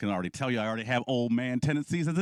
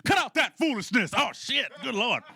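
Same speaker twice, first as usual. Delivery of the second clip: high, raised-pitch fed-up holler; snapped old-timer scolding; 11 semitones higher than usual. Retold or imitated. Man on his porch scolding swearing kids, comically snapped. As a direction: snap at misbehaving kids, hammed up. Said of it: "finally I just snapped".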